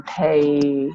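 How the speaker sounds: ordinary talk, nothing marked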